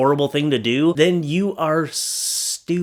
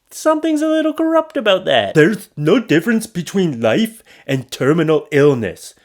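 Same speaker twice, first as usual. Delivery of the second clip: sarcastic high voice; 4 semitones higher, 4 dB louder than usual. Acted. Sarcastic remark on election fraud.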